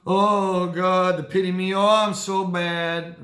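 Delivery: loud, high self-pitying wail; hammed-up pity-me act; playing the victim. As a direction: wallow in mock misery. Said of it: "would be the victim".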